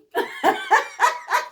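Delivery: high laugh rising at the end. Real, not acted. Laughing as she recalls that mom didn't beat her. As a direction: let a laugh break out. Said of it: "She didn't beat me".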